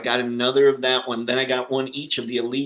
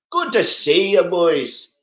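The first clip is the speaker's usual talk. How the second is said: higher, louder, with a put-on twang; cheery put-on accent for the viewers; 5 semitones higher, 4 dB louder, slower than usual. Shown as a demonstration. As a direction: ham up a folksy accent on the greeting. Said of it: "I'm kind of slip into some kind of accent".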